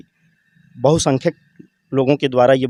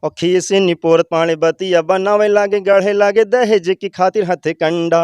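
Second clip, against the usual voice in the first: poem recitation; 7 semitones higher, 4 dB louder than usual. Acted. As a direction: belt out the satirical verse like a stage poet. Opening verse recited in dialect.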